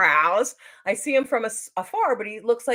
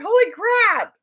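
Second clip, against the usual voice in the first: high, loud retold astonishment; amazed exclamation; 14 semitones higher, 6 dB louder, slower than usual. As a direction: blurt it out, overwhelmed with delight. Retold amazement at being recognised.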